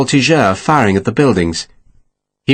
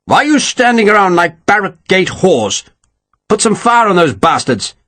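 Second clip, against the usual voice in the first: furious; 8 semitones higher than usual. A narrator's reading. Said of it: "He turned furiously on the gunners".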